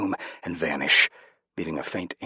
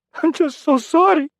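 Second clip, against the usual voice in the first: much higher, much louder wailing apology; crying; 12 dB louder than usual. Acted. Rodrigo weeping to Jose after the exorcism, crying apology. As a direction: sob the words out. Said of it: "he cried".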